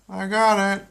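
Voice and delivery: high, loud goofy dad voice; a dopey husband delighted; Peter voice. Acted. Peter to his wife, loud and high. Peter drinking spilt beer.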